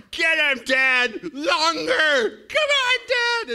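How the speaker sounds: put-on very high, loud yelling like an excited child; full-force imitation of children shouting; kids egging Dad on. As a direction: shriek it like a kid cheering Dad on. Voices his kids egging Dad on.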